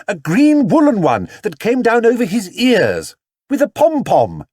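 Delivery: high, loud shout over the noise. Acted. Mrs Brown shouting to the detective over the crowd noise. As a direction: yell it over the din. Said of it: "shouted Missus Brown in return".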